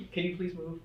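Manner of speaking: overly polite mock request; mock polite quote. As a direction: ask sweetly, mockingly. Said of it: "your ask was".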